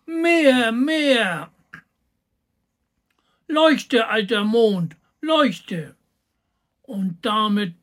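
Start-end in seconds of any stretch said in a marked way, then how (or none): 0.0-6.0 shouting. A narrator's reading. Little boy ordering the moon to shine, shouting. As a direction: cry out each command like a greedy child. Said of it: "schrie Häwelmann".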